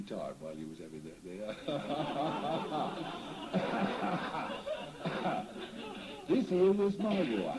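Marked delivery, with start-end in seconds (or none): 1.6-6.2 long wheezing cackle, higher; hearty laugh. Real, not acted. Spirit laughs about doing his time. 6.3-7.6 proud declaration. Real, not acted. Proud declaration after laughter.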